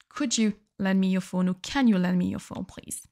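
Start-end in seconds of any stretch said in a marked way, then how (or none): none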